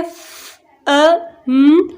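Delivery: "फ-, अ, न" as three separate sounds, long gaps. Shown as a demonstration. Teacher showing fun has three sounds, sounded out separately. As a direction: sound out फ, अ, न slowly one by one. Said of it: "फन में भी तीन sounds हैं".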